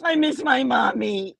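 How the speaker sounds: mock bawling little kid, loud and drawn out; hammed-up tearful child; crying child. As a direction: wail like a kid missing mom. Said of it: "Why are you crying".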